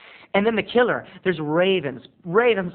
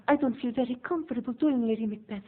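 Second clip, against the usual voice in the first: put-on timid young Betty; 7 semitones higher, 5 dB quieter than usual. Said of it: "young Betty's like".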